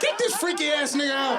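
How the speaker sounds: high-pitched mock call for removal; mock outrage. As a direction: call out in pretend indignation. Mock ejecting an audience member.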